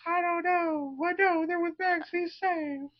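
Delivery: very high falsetto character; mock high-pitched reply. Adult mocking the answer given to Vecna, silly very high voice. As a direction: squeak out an innocent answer.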